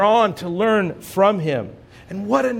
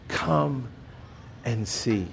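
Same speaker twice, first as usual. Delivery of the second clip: hushed reverent; 6 semitones lower, 9 dB quieter, slower than usual. Shown as a demonstration. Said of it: "most profound words".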